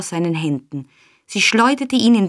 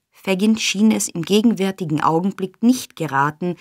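ordinary delivery, nothing marked

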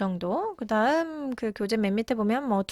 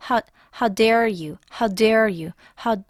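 ordinary delivery

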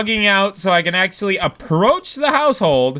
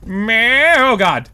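normal talking voice

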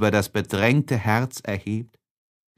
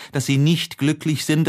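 ordinary delivery

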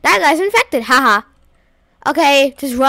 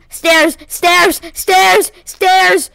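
frantic shouting; 6 semitones higher, 6 dB louder, much slower than usual. Real, not acted. Fleeing a chaser.

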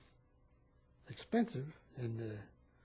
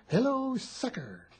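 slow, higher, spelled-out hello sucker; slow teaching; 8 semitones higher, slower than usual. Shown as a demonstration. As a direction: say each word slowly for the kid to copy. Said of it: "he couldn't say sucker".

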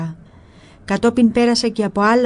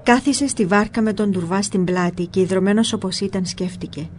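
usual speaking voice

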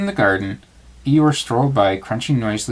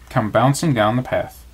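usual speaking voice

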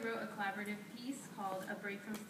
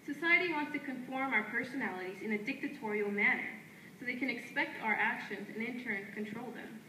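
firm, declaiming poetry lines traded back and forth; spoken word duet; 6 dB louder than usual. Acted. Two poets trading lines on stage, in a firm declaiming delivery. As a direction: answer your partner line by line like a chant.

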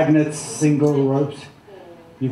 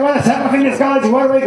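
higher, laughing showman command; hypnotist command; 9 semitones higher, 4 dB louder, faster than usual. Acted. Hypnotist to his volunteers on stage, laughing high command. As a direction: snap them awake like a stage hypnotist.

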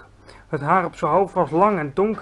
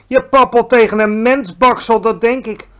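loud, raised giant voice; roaring accusation; 6 semitones higher, 8 dB louder than usual. A narrator's reading. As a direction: hurl the accusation at full force. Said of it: "brulde hij".

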